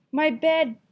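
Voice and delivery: high, loud outcry; startled shout. Acted. Papa bear sees his messed bed, a loud high outcry. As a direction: cry out in shock.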